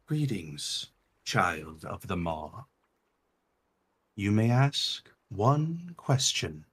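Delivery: quiet and slow, ceremonial; an ancient being granting a question; solemn heart voice. Acted. The heart speaks.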